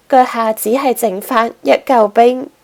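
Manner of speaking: usual speaking voice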